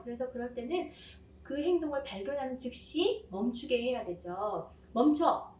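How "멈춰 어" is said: put-on sharp loud "멈춰!" to halt a child; mom's firm shout to stop hitting; firm stop command. Shown as a demonstration. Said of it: "이렇게 해야 될 거예요".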